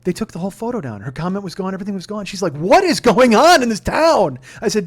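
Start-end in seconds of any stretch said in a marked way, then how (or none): 2.5-4.4 loud, slow, disbelieving question; outraged. Retold or imitated. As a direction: demand an answer from the whole town. Wife's outraged quote.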